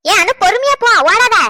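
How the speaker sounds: loud, high warning call. Acted. Mother calling after her running pregnant daughter, loud and worried.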